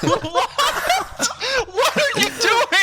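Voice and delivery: high squeal through laughter; laughing protest. Real, not acted.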